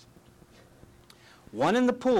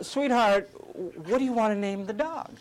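mother's voice; 6 semitones higher than usual. Retold or imitated.

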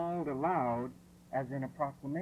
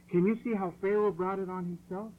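normal talking voice

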